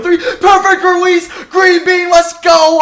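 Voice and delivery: real, loud, high triumphant yelling; excited shouting. Real, not acted. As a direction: celebrate the big shot at the top of your voice. Hits a clutch three in game.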